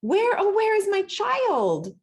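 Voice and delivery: put-on a mother suddenly missing her child; frightened mother. A narrator's reading. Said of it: "turned around and gasped".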